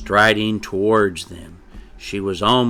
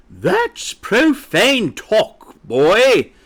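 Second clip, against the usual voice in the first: high, loud, drawn-out scolding; disapproving mother hen; 13 semitones higher, 7 dB louder, much slower than usual. A narrator's reading. Mother hen catches her boy talking, loudly disapproving. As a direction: scold with stretched words, pitched high. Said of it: "said a disapproving voice from behind them".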